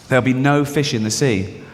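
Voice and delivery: ordinary delivery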